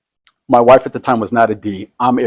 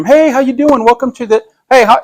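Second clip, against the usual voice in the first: much higher, bright open-house greeter voice; cheery greeting; 12 semitones higher, 5 dB louder than usual. Retold or imitated. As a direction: sing out a big eager welcome at the door. Agent greeting visitor at open house.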